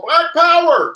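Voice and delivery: mock rallying cry. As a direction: shout it like a rider in the street. Man riding stolen horse shouting.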